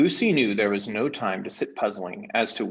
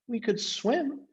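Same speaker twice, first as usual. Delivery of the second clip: put-on very high, slow girl's voice; a small helpful suggestion; Lucy; 13 semitones higher, slower than usual. Lucy suggesting to Caspian, very high and slow.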